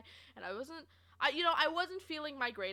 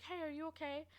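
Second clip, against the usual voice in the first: soft, higher-pitched caring check-in; kind concern; 5 semitones higher, 7 dB quieter than usual. Retold or imitated. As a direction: imitate a nice manager gently checking in. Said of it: "my nice manager".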